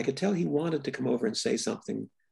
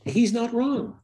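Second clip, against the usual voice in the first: bright, eager, a bit higher and louder; gleeful agreement; 9 semitones higher, 5 dB louder than usual. Real, not acted. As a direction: jump in delighted to agree. Gleefully agreeing with critic son.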